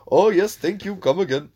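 mock Apu thanking a customer; Apu impression. Imitating Apu at the Quickie Mart counter, hammed-up Indian accent. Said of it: "Like that".

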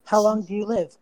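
normal talking voice

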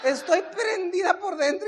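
high, slow mock-earnest voice; hammed-up imitation of her reassurance; mimicked wife. Husband mimicking wife's inner excitement, high slow voice.

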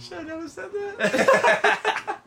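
high, loud and slow through laughter; laughing. Real, not acted. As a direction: laugh out the words. Laughing at her own answer.

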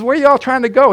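lightly voiced sly prophet; teasing Elisha. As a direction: toy with the blind army. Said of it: "And he says".

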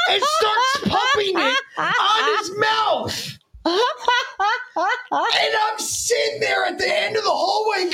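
high, laughing storyteller shout; drawn-out, high-pitched hilarity; laughing hard. Real, not acted. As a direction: barely get the story out through rising laughter. Cracks up telling the prank story.

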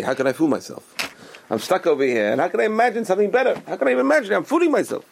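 high-pitched exasperated questioning; incredulous protest. Acted. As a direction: voice climbing high in mock disbelief. Voicing a doubter's protest.

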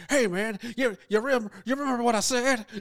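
high, fast, hammed-up pleading; mock pleading George. Imitating George pleading with the bartender, hammed-up. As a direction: beg the bartender desperately. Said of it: "and he's like".

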